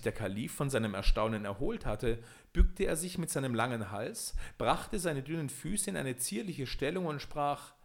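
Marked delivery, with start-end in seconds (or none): none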